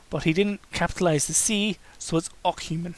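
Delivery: usual speaking voice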